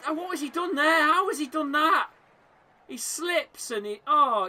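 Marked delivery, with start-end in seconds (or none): 0.1-2.1 disbelief. Real, not acted. Disbelief at open goal miss.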